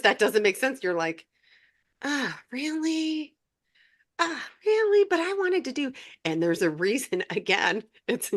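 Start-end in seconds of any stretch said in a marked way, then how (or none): 2.0-3.3 slow, drawn-out, higher reluctant sigh of "Ah, really?"; reluctant whine. Retold or imitated. Talking back to spirit guides, a mock reluctant moan. 4.2-5.9 much higher, pleading "Ah, really?"; disappointed protest. Retold or imitated. Retelling her answer to the guides, a higher mock whine. Retold reluctance at the guidance.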